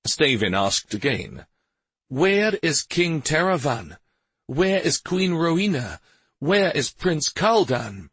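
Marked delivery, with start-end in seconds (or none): 2.1-8.1 stern demand. Acted. Stavin interrogating the priest.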